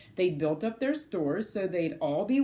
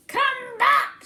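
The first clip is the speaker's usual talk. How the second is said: very high, loud shout; 6 dB louder than usual. Acted. Cyril shouting after the bird. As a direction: call after the cone. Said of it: "shouted Cyril".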